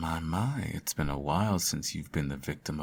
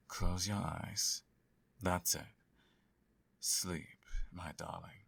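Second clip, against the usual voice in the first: softer and softer, slowing to a whisper; soothing lull to sleep; 9 dB quieter than usual. Acted. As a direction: fade them gently into sleep. Lulling the hero to sleep.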